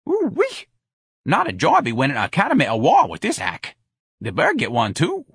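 indignant janitor full of whoops; lively sardonic janitor. Acted. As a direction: wisecrack with big high exclamations and swooping pitch.

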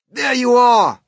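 high, loud triumphant call. A narrator's reading. Police chief proved right before his men, loud call. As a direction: call it out as proof. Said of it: "called the chief of police".

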